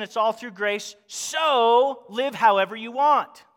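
loud, drawn-out mock conclusion; voicing false teaching. Preacher voicing a mistaken doctrine to his congregation, loud and drawn out.

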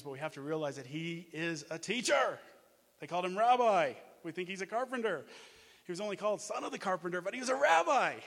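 loud, high, driving sermon voice; full-force preaching. Acted. Preacher urging the congregation that Jesus is a teacher, full-force preaching. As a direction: preach it out, proclaiming.

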